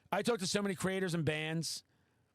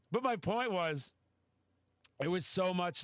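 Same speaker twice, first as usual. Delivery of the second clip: ordinary delivery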